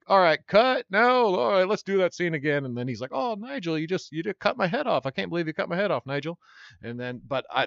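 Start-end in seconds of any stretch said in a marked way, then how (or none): 0.1-2.6 brisk director's voice, higher and louder; director calling cut. Retold or imitated. Retelling the game ad, he voices a director calling cut. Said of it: "you hear this director say". 3.1-6.4 "Oh, Nigel" pitched higher, the rest close to his own voice; knight's scripted line. Retold or imitated. Voice actor quoting the ad script before his whiny take, lightly voiced. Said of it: "that's not how I read it".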